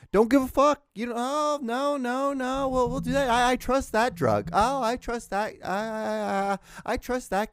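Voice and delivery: high-pitched mocking imitation of a naive believer; mock gullible Ozempic taker. Podcaster mimics people taking Ozempic, high mocking voice. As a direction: put on a high, silly, trusting voice.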